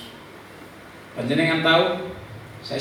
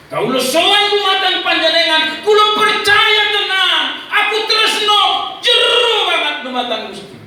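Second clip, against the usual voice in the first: fervent shouted prayer; 9 dB louder than usual. Retold or imitated. Believer declaring love to Gusti, fervent shouted prayer. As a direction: pour the prayer out at full voice.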